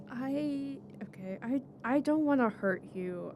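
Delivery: ordinary talk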